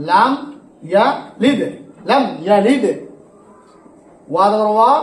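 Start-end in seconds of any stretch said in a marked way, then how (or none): none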